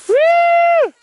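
loud, high whoop; cheering whoop. Real, not acted. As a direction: whoop them down the hill. Cheering Kara.

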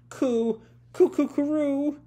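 high-pitched pigeon cooing. Retold or imitated. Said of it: "You are a pigeon".

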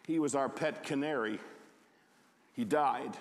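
ordinary delivery, nothing marked

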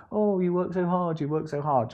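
a lightly voiced fawning spouse; mock soothing partner. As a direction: coo over the tired worker. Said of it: "expect the other person to say".

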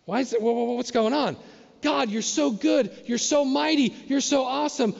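excited praise. Retold or imitated. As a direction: gush with rising admiration. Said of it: "they say".

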